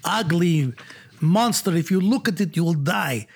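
a hint of Russian as the mad doctor; Russian doctor accent. Schoolboy on stage to the assembly, light mock Russian accent. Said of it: "And I say".

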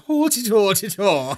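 high silly Irish-sounding chirp; sing-song nonsense like the leprechaun; Irish leprechaun noise. Retold or imitated. Friend imitating the leprechaun sounds in high mock Irish. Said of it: "I been going".

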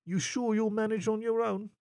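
lightly flirty; coy question. Acted. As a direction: tease him about being alone. Narrator flirtatiously probing.

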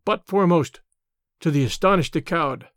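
usual speaking voice

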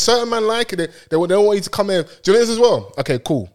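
ordinary talk, nothing marked

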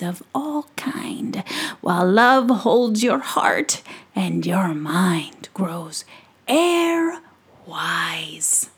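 slow, breathy chanted verse; crusty old gnome reciting. Acted. Old gnome muttering his verse to himself, slow and hushed. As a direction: intone the rhyme like an ancient spell.

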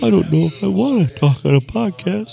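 high timid listener voice; squeaky nervous caller; mock timid listener. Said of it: "imitating timid listener".

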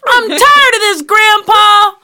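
put-on high, loud whine of a tired kid; whiny kid and grumpy grandpa. Cousin joking about being kept in the studio, whiny high kid voice. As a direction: whimper like a worn-out grandchild.

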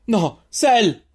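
high, loud cry of alarm; desperate shout. A narrator's reading. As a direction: cry out after someone being taken. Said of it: "urlò Zalen". (urlo disperato)

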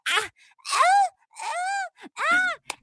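very high stammering ah; high panicked mock stammer; stammering squeal. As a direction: squeal out the dodge. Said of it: "She goes".

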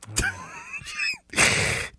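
high burst of laughter. Real, not acted. Laughing at himself.

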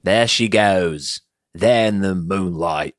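slow, hammed-up crooning film star; old film star impression. Doing famous people for his cohost, slow hammy film-star voice. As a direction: drawl it like a golden-age movie idol. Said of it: "Is it Tony Curtis".

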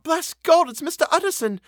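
very high, loud cry of relief; high-pitched exclamation. A narrator's reading. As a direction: cry it out high like an overwrought cook. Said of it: "crying out".

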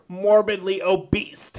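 "morbidly obese" drawn out slowly, mockingly; mimicked pronunciation. Host mocking the clip's wording, "morbidly obese" said slow and deliberate. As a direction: stretch out "morbidly obese" like a quote. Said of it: "as you say".

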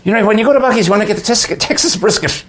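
put-on high, excited Irish-accented priest. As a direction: play an eager Irish priest urging friends on. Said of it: "And he says".

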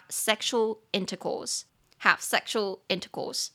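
"have sexual intercourse" said slowly and clearly; slow careful repetition; slow model phrase. Shown as a demonstration. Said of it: "有礼貌的去讲".

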